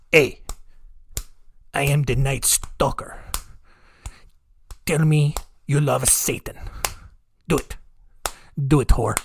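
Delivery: low, halting mock menace; mock deranged killer. Killer demanding conversation while punching his victim, mock deranged menace. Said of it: "It's just like".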